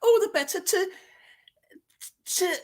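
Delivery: high flustered granny stammer; spluttering fake granny. Wolf caught out, spluttering as Grandma. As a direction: stumble for words in granny voice. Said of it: "I spluttered".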